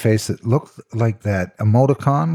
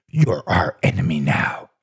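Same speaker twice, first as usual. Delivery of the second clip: hushed, voiceless whisper; whispered declaration of enmity; 3 semitones lower than usual. Acted. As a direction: breathe it like a secret threat. Friends turning on Roger.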